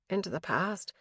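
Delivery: plain reading voice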